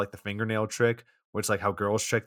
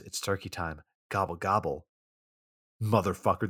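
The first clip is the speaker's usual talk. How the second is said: mock seductive then menacing; 2 semitones lower than usual. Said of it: "Or better yet, she says".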